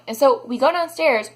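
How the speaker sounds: ordinary delivery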